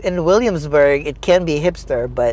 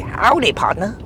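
put-on country accent; 6 semitones higher than usual. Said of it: "That was better".

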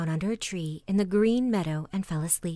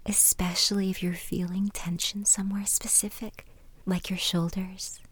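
guided meditation. Acted. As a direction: ease them gently into calm, with long pauses. Leads a relaxation exercise.

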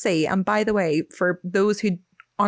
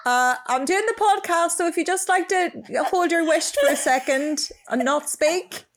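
much higher, sweetly firm request; raised-pitch aside to someone in the room; pointed shushing; 10 semitones higher than usual. Real, not acted. Shushing boyfriend who walked in.

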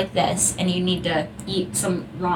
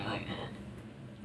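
whispering; 12 semitones lower, 17 dB quieter, much slower than usual. Retold or imitated.

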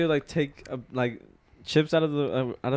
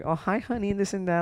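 mock sugary mom greeting, higher; mom's sweet voice; 7 semitones higher than usual. Son imitating his mom's fake-sweet hello to him. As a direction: put on the mother's sudden sweetness. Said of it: "my mom's like".

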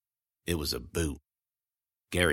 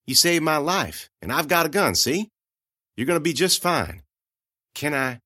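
higher, gentle coaxing voice; Amanda reassuring; 8 semitones higher than usual. Acted. Narrator comforting the shivering girl in a higher gentle voice.

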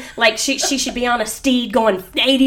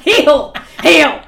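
laughing; 6 semitones higher, 8 dB louder than usual. Real, not acted. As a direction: repeat the word through real laughter, high and loud. Repeating the joke while laughing.

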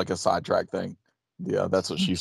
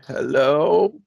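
put-on lightly quavering elderly hello; old person voice; 7 semitones higher, 4 dB louder than usual. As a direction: answer like a confused old person. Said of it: "doing, like, this old person voice".

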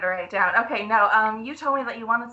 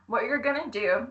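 ordinary talk, nothing marked

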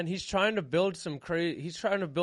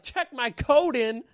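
higher, louder, slow puzzled echo; can't believe the offer; baffled; 8 semitones higher, 6 dB louder, slower than usual. Retold or imitated. Baffled by coat check.

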